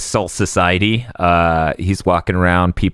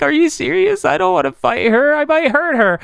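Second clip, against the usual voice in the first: high-pitched, hammed-up objection; mock incredulous protest; 14 semitones higher than usual. Ichigo balks at fighting a little girl, in mock disbelief.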